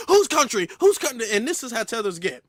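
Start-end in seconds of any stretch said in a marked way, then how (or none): 0.1-1.3 raised, louder mock indignation; mocking the guest's outrage. Streamer mocking the guest on stream, in raised mock indignation. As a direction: throw the guest's words back, higher and louder. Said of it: "He damn near".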